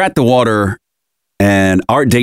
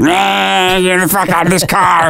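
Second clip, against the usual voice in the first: put-on high whiny toddler voice; mock a cranky toddler in the car seat; toddler tantrum; 10 semitones higher, slower than usual. Father imitating toddler son fussing in the car, high whiny tantrum. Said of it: "So he just kinda got up and was like".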